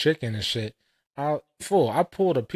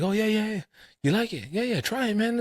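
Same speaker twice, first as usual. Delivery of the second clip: much higher pitched, pushy host voice; mock eager restaurant owner; 11 semitones higher than usual. Restaurant owner urging the guest to taste, high mock voice. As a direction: coax him to try the food in a high put-on voice.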